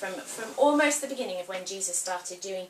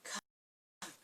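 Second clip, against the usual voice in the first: whispered come; 16 dB quieter than usual. Acted. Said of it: "So Jesus says".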